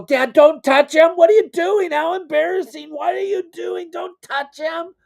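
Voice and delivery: very high whiny mock panic; hiss-free frantic plea to make dad stop; mortified son. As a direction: son begging his embarrassing dad.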